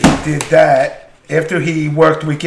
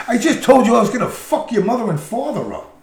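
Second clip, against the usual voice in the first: higher, laughing disbelief; incredulous; 9 semitones higher than usual. Retold or imitated. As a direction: remind him of the threat in disbelief. Incredulous retort in retold story.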